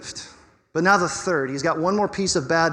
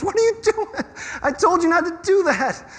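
much higher put-on voice of an exasperated parent; 12 semitones higher than usual. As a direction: scold like a shocked mother. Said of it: "And so God goes".

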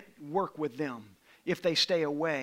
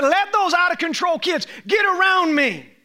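high, loud, fervent preaching; preaching at full force; 10 semitones higher, 8 dB louder than usual. Acted. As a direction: drive it home to the congregation. Preaching climax.

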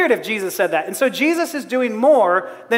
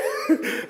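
high squealing laugh; 6 dB quieter than usual. Real, not acted. Laughing at being ticklish.

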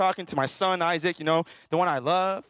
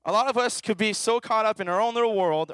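ordinary talk

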